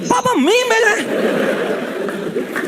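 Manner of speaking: high, slow laughing words; laughing while quoting. Retold or imitated. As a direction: quote her while cracking up. Retold mother laughing quote. (हँसते हुए, ऊँची आवाज़ में)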